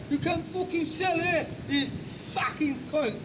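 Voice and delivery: high-pitched, aggressive Cockney demo; exaggerated Cockney with loud swearing bursts; Cockney accent. Shown as a demonstration. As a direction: threaten like a Cockney street tough. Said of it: "Here's Cockney".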